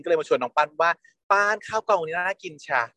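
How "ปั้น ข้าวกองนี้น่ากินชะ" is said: high, slow, sugary invitation; sweet coaxing. Retold or imitated. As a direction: lure him in sweetly, stretching each word. Coaxing co-star to eat.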